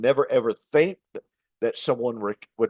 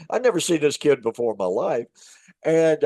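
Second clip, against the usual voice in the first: ordinary talk, nothing marked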